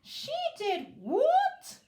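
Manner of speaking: high, loud, slow shocked king's cry; astonished royal outburst. Acted. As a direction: shout it in disbelief. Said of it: "cried the king".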